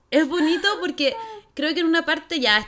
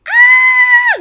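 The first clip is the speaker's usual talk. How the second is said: long high-pitched scream; loud fangirl shriek, re-enacted; 15 dB louder than usual. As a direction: let out a piercing, sustained squeal.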